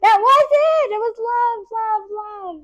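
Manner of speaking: teacher thrilled the class found the word love; excited. Real, not acted. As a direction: celebrate finding the word.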